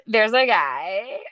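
very slow, drawn-out mock announcement; teasing imitation. Woman teasing her secretive friend, drawn-out imitation. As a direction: imitate a friend dragging out the news. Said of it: "You sit down and you go".

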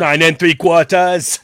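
slow, drawn-out attempt at British; stretched-out would-be British accent. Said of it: "do it in a British accent".

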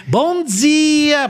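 high, sing-song cheery morning greeting; imitating Kelly Matos. Radio colleague mocking a morning host's over-happy greeting, high and sing-song. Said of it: "meio tati-bitati".

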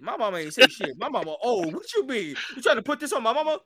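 high, hammed-up outrage; mock indignant protest. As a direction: play a son cutting off talk about his mama. Acting Tenzin cutting off mama talk.

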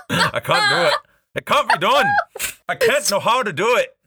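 high-pitched mock defeat in a put-on voice; comic despair. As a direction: give up theatrically, voice shooting up high. Comic surrender after failed Scottish accent.

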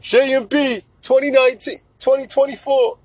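high-pitched rally call; hammed-up hype for a candidate; mock campaign cheer. Mock campaign chant for [name].

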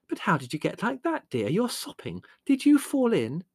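high, anxious mother voice; high-pitched motherly dismay; fussing mother. A narrator's reading. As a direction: fuss over a soaked child. Dismayed mother questions muddy son.

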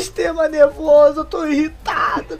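high, tearful retold call to dad; crying to dad. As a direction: sob it out like a frazzled kid. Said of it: "eu liguei pro meu pai chorando".

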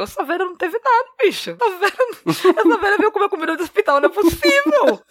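high-pitched amused incredulity; comic disbelief. Retold or imitated. Grandchild marvels at grandma recovered from a stroke, comic disbelief. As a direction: exclaim it high, laughing at the absurdity.

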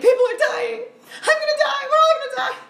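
mock-panicked screaming, high and loud, half laughing; laughing mock terror at full volume. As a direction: shriek it like the plane is falling on you. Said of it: "I was like".

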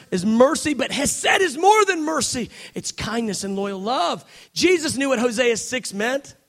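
ordinary talk

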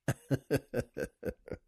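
low, soft run of laughter; real, subdued laugh; quiet chuckle. Real, not acted. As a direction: chuckle softly at your own joke. Laughs at own punchline.

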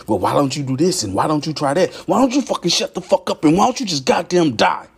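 angry tirade, pitch climbing to the end; mounting rant that breaks into high-pitched fury; fed-up outburst. Acted. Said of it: "That's how I'm feeling".

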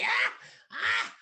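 short, loud mock screams; imitated pain scream. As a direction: yelp as if burned, hammed up. Imitating victim's cries in torture scene.

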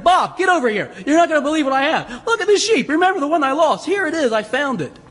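high, excited call to a neighbor; overjoyed shepherd. Acted. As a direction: act out bursting to share good news. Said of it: "He's so happy".

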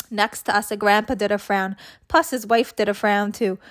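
ordinary delivery

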